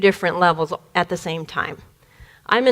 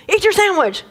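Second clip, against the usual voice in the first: impatient father; 8 semitones higher than usual. Retold or imitated. Father repeating his order.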